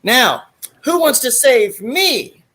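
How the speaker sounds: high, slow, teasing come-on; flirty instructor. Retold or imitated. Blonde instructor luring the cadets, high slow teasing. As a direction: draw it out as bait.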